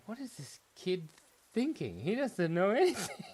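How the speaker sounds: high, loud incredulous scoff; mock disbelief. Remembering a clueless kid in class, incredulous. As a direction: scoff at the kid, breaking into a laugh.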